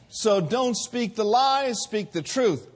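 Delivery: "lies" slowed and pressed, "truth" hit; slow, firm exhortation; preached emphasis. Acted. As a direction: drive the contrast home. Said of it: "preaching exhortation".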